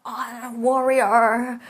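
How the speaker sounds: loud, slow, drawn-out war cry; hammed-up proud warrior shout; mock heroic declaration. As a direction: bellow it like a cartoon warrior. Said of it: "She's always like".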